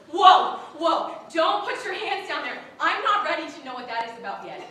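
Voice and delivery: loud, hammed-up panic; mock alarmed protest. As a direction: halt someone's advance with a comic yelp of protest. Acts out stopping awkward sexual advances.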